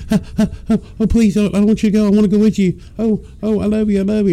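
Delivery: high, fast whining dog begging; excited dog. Retold or imitated. Dog pleading as owner leaves for work, high excited whine. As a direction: play a frantic needy dog, way up high. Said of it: "It's like".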